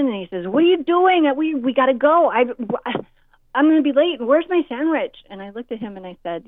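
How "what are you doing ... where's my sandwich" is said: much higher, loud demanding whine; impatient teen whine. Retold or imitated. Teen son demanding mom hurry, loud and whiny. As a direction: barge in and complain like a late teen.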